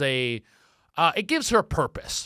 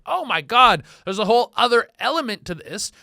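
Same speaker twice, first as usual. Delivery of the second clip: shocked realization; 7 semitones higher, 6 dB louder than usual. Retold or imitated. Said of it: "And I was like".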